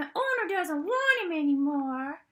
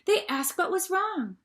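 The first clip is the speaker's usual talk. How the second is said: plain reading voice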